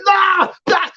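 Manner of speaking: high protesting nah nah; mock protest. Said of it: "You was like".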